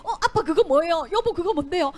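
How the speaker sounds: loud, bright curious question; family member asking. Acted. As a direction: ask eagerly what dad brought. 아간 가족이 묻는 장면. (가족이 묻는 목소리)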